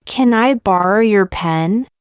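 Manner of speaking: usual speaking voice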